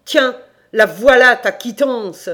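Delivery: loud, high, slow and emphatic; angry shout. Acted. Damned landlord handing over a receipt, loud angry shout. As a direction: throw the words angrily at him.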